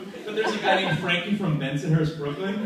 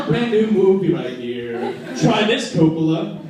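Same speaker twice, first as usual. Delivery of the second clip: announcer voice; 7 dB louder, slower than usual. Acted. Acted E-ZPass pitch.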